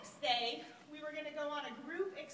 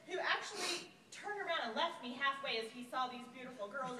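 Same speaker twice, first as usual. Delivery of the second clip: normal talking voice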